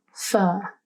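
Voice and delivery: "fer" alone as the stressed syllable; stressed second syllable. Shown as a demonstration. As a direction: isolate "fer" from the word. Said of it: "emphasis ... is on the second".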